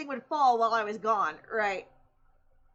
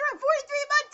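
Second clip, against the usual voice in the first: high, loud excited cry; excited exclaim; 14 semitones higher, 6 dB louder than usual. Real, not acted. To the chat, a thrilled high exclaim. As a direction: gush it out, thrilled.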